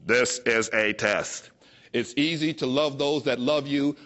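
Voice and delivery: low, slow spiritual inner voice. Acted. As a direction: speak like a calm divine voice inside her head. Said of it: "this voice in my head says".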